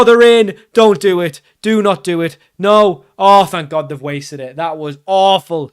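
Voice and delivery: ordinary talk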